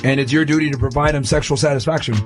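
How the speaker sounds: ordinary talk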